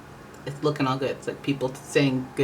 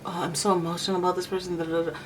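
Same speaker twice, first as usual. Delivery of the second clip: mock emotional gush; 3 semitones higher, 5 dB quieter, faster than usual. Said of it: "not like".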